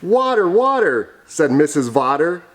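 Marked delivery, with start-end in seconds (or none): 0.0-1.1 high, swooping storybook cry; high-pitched pretend urgency; urgent calling. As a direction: call out for young listeners. Story character calls for water.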